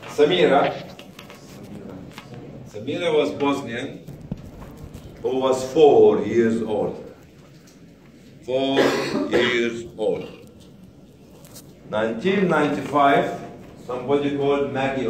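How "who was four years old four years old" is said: FOUR said slow and heavy; slow stress on four. Acted. Speaker to audience, slow stress on four. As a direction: dwell on the word four. Stresses the girl's age slowly.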